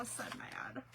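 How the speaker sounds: whispered, half-hearted fury; a playful whispered rant; mock whisper. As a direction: hiss it under your breath like a mock tantrum. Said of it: "I'm like".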